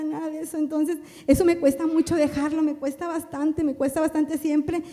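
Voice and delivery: normal talking voice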